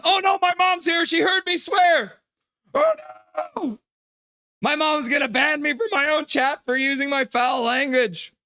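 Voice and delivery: high, loud, squealing mock alarm; mock panic. Streamer caught swearing as mom enters chat, hammed-up panic. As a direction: play caught-out kid in a shrill fake panic.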